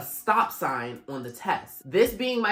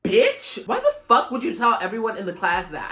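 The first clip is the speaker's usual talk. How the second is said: high, loud indignant yell; indignant outburst; 6 semitones higher, 6 dB louder than usual. Retold or imitated. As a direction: shout it out, offended. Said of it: "I was like".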